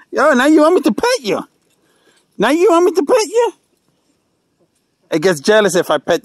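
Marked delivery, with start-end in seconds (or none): 0.1-3.5 1 high pet talk. Real, not acted. Talking to animal baby voice.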